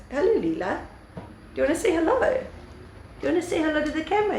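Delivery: high, sing-song coo at the dog; high cooing voice for a pet. Real, not acted. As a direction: coax the dog to say hello. Greeting her niece's dog on camera.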